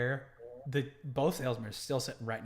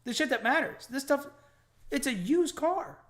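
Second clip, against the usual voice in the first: high, loud, exasperated protest; pushing back, voice climbing; retold exasperation; 11 semitones higher, 4 dB louder than usual. Arguing with the salesman about the car, high and exasperated. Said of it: "I was like".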